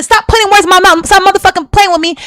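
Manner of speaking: repeating the heated words, pitched high; retold angry demand. Woman repeating the exact words she said before leaving, heated. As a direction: hammer the same angry line home again. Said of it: "I said".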